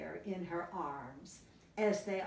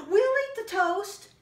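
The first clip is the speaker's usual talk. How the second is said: high, loud eager cub voices; 13 semitones higher, 10 dB louder than usual. Acted. Three cubs claiming the toast, high and loud.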